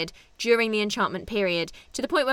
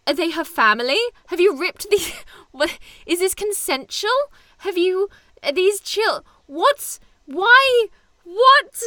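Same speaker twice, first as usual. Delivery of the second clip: high, incredulous questions, broken off and building louder; rising comic disbelief, ending loud and high; mounting bafflement; 8 semitones higher, 6 dB louder than usual. Real, not acted. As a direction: flounder from one appalled question to the next. Baffled rant about skunk family.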